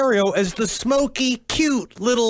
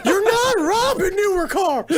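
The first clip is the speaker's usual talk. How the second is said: high, exasperated scolding voice; hammed-up frustrated boss; mock angry rant; 9 semitones higher than usual. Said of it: "and P was like".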